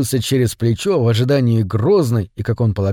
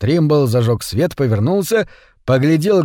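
ordinary delivery, nothing marked

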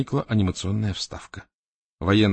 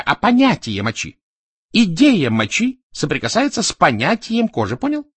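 higher, louder animated explainer; Березовский; 7 semitones higher, 6 dB louder than usual. A narrator's reading. Explaining to Salman what is in his head, animated and insistent. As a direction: press the argument home, pitched up. Berezovsky lectures on ideas.

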